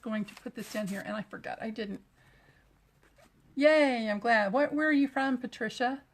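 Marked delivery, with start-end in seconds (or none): 3.5-6.0 cheering a viewer, much louder; happy near-yell at the mic; greeting viewer Patricia too loudly with excitement; excited loud greeting. Real, not acted. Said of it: "I feel like I'm yelling at you".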